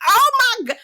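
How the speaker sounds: high, loud gasp of disbelief; real stunned outburst; shocked oh my God. Real, not acted. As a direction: cry out in shock. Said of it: "I was like".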